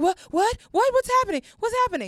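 reliving her terrified scream at the raid; panicked shriek. Retold or imitated. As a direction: shriek it in retold terror. Panic during raid retold.

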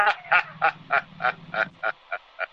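long, rattling staccato laughter; sustained mocking laugh. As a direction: keep laughing through his protest. Intruder keeps laughing at writer.

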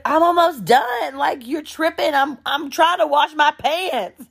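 high, whiny protest; imitated indignant man. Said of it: "He's like".

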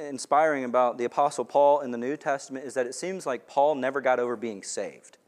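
ordinary talk

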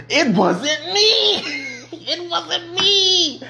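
high, slow, laughing it wasn't me; mock innocent laughing denial. Denying to dad, laughing.

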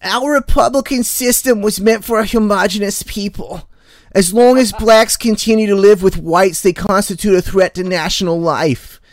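high, loud, hammered-out impersonation; Doctor Fauci. Acted. Co-host reading a Lincoln quote as someone else, Fauci impression. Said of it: "I'll do a Doctor Fauci, uh, way".

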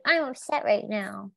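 a hint of a small pouting girl, slowed; lightly voiced sulky little girl; cute little girl. Friend playing back her mood, light little-girl pout. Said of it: "a really cute little girl".

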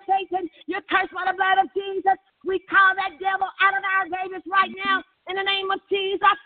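high, relentless preacher's chant on one note; fierce rebuke. Real, not acted. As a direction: cast out the devil in insistent, intoned cries. Rebuking the devil in prayer.

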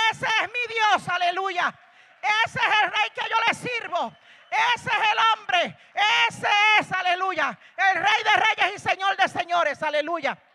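high, loud jubilant proclamation; shouting praise. Pretend. Believer proclaiming God's greatness to everyone, shouting joyfully. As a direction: cry it out to the whole church. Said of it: "es para que gritemos a voces".

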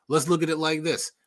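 ordinary delivery

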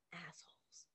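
unvoiced soft whisper; hushed insult. Real, not acted. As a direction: breathe the word out secretly.

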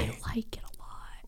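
hushed secretive whisper. Real, not acted. Admitting she likes the Dahmer show, whispered. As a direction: confess it in a whisper.

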